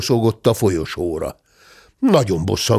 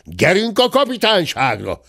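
higher-pitched commanding call; servant's shout; 8 semitones higher than usual. A narrator's reading. Servant hauling the gentleman to the police, commanding shout. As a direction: order him off with a mild shout. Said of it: "kiáltott a háziszolga".

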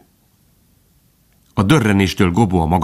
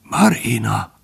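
effortful, failing call; labouring to be heard; strained; 3 semitones higher, slower than usual. A narrator's reading. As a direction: force the name out with difficulty. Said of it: "mondta erőlködve".

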